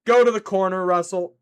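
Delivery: loud, firm command; scolding order. Real, not acted. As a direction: send the culprit to timeout loudly. Sending Russell to timeout.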